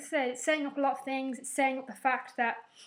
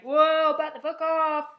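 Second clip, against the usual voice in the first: loud, raised-pitch bodyguard warning; mock warning shout; 5 semitones higher, 9 dB louder, slower than usual. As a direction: imitate guards pushing a crowd back. Voices bodyguards warding off crowd.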